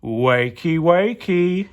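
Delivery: rising teasing "wakey, wakey"; sing-song villain call. Acted. Evil pea waking the peas, teasing call. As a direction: call them awake with a taunt. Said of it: "he called".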